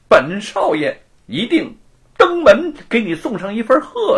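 high, loud sneering taunt with laughs; smug gloating. Acted. Mounted young master gloats over Wu, smug and mocking. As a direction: savour every insult. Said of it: "洋洋得意地说".

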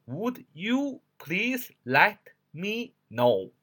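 very slow, each word of "Would you please let me know" separate. Shown as a demonstration. Teacher shows the unlinked reading, one word at a time. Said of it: "一个字儿一个字儿读是".

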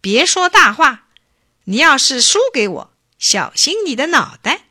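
loud, high-pitched scornful warning; sneering threat. A narrator's reading. Chief warns the boastful trickster, sneering. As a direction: warn him off with a touch of scorn. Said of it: "头人冷笑着说".

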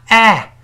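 哎 as the open "a" of salad, high and loud; front vowel. Shown as a demonstration. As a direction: punch out 哎 as an isolated English short-a vowel. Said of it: "注意前面发哎".

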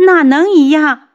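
loud, high indignant retort; high-pitched angry outburst; angry scolding. A narrator's reading. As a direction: yell it, neck straining with outrage. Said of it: "扯着脖子骂".

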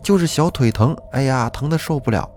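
high, whimpering mock sob; imitating tearful whining. Little girl tells her mother her legs hurt, in a mild tearful whine. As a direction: replay a crying child's complaint, lightly. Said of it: "一把鼻涕一把泪，口齿不清地说".

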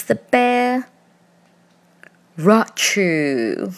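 rachoo done as a light sneeze sound; playful storybook sneeze; mock sneeze.

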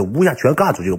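ordinary delivery

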